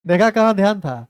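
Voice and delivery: loud, sharp classroom rebuke; scolding shout. Real, not acted. Teacher scolds a distracted student in front of everyone, loud rebuke. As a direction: call her out in front of the class.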